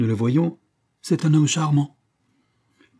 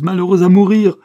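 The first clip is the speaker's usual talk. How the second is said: cri de désespoir; 7 semitones higher, 10 dB louder than usual. Acted. Marthe désespérée devant son père.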